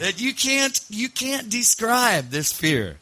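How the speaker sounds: high-pitched, laughing disbelief; laughing recollection. Real, not acted. Laughingly recalls his terror.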